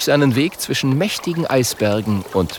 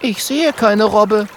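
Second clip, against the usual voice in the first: put-on much higher, know-it-all girl; Mathilda's voice; 11 semitones higher, 3 dB louder than usual. Mathilda explaining the North Pole to the boys, high and knowing. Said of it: "sagt Mathilda".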